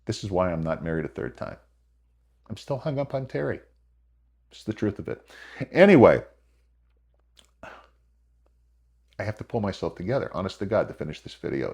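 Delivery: soft, choked-up, trying to recover; quiet words while pulling himself together; holding back tears. Real, not acted. Widower remembering his late wife, softly fighting tears. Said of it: "Oh, I'm gonna cry again".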